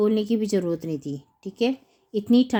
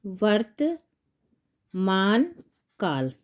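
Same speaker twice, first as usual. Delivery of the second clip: slow dictation; much slower than usual. Shown as a demonstration. Teacher writing the tense's name, slow dictation.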